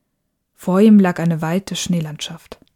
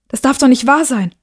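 higher, agitated disbelief; dismayed exclamation; 6 semitones higher, 4 dB louder than usual. Acted. Boy finds the window stuck, dismayed. Window will not open.

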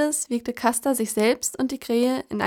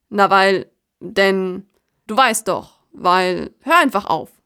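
verlegenes Stocken; 2 semitones lower, 4 dB louder, slower than usual. Acted. Kasta stottert nach Ausreden.

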